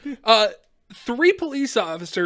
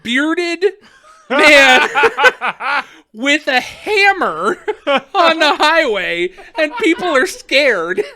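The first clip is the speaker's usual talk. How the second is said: slow, loud, higher, breaking into laughter; imitated cop building to a punchline; mock official report; 6 semitones higher, 8 dB louder, much slower than usual. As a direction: stretch each phrase through the laughs. Cop's punchline, teller laughing.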